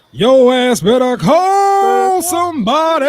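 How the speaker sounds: slow, high, loud mock yell; mock shout. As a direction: drag it out in a big shout. Mock threat in big voice.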